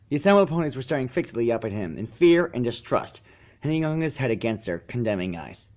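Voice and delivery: ordinary delivery, nothing marked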